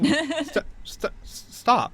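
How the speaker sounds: a high, flustered fairy trying to stop him; fairy stammering stop. Acted. Fairy stammering at the player poking her.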